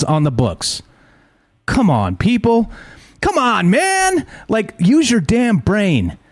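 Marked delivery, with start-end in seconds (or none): 1.6-6.2 exasperated high outburst, slow on the last lines; pleading with people to use their brains, angry and slowed down; fired up. Real, not acted. Ranting at lawmakers' excuses, fired-up exasperated outburst. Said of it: "sound a little fired up".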